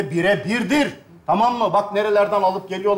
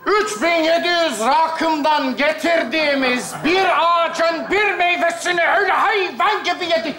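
higher, outraged shouting; angry shout; 5 semitones higher than usual. Acted. Furious at Yalayut for eating the precious fruit, shouting. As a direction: yell in fury at the thief.

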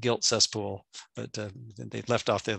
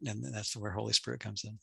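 ordinary talk

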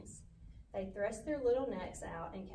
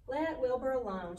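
sharply, louder and higher; sharp rebuke; 4 semitones higher, 7 dB louder than usual. A narrator's reading. Charlotte tells the lamb to leave Wilbur alone, sharply. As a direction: speak up sharply in someone's defense. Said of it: "she spoke sharply".

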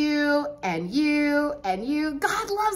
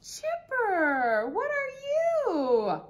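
host amazed at the angel puppet; amazed wonder; 4 semitones higher, much slower than usual. Acted. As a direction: marvel at the costume. Puppet appears dressed as angel.

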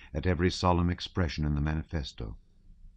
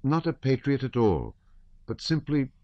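put-on a hurt young girl, voice raised high; protest, stung and offended; injured tone; 7 semitones higher than usual. A narrator's reading. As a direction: Natasha hurt by Shinshin's joke, high and injured. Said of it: "in an injured tone".

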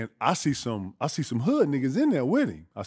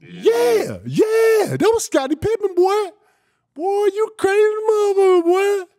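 much higher, loud hyped yelling; excited shouting; 10 dB louder, slower than usual. Retold or imitated. The speaker yelling at the crazy player, high and loud. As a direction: holler it like you can't believe it.

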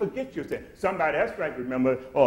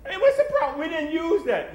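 indignant wife; 13 semitones higher than usual. Retold or imitated. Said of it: "Rose said".

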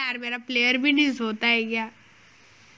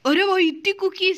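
higher-pitched amazed outburst; surprised exclamation; 6 semitones higher, 4 dB louder than usual. Real, not acted. Player is handed a pile of cookies, surprised exclamation. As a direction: blurt it out, pleasantly surprised.

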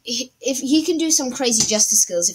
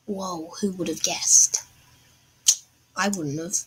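whispering; 3 semitones lower, 3 dB quieter than usual. Real, not acted. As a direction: breathe a sly whispered wonder. Quiet aside while playing.

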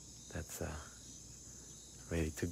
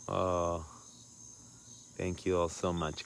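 ordinary delivery

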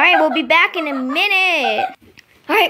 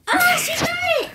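high, fast excited shriek; excited squeal; 14 semitones higher, faster than usual. Real, not acted. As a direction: shriek with delight. Dog grabs the toy.

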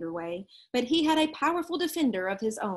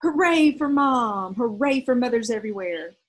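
triumphant hooray, held long; joyful inner shout; 7 dB louder than usual. Acted. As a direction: cheer it out like a kid whose mom just took his side. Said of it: "in his mind he shouted".

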